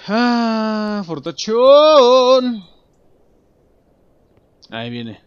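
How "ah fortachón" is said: loud high very slow stretched word; awed drawn-out call. Real, not acted. Player spotting the strongman, loud slow awed cry. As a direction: draw out the name in awe.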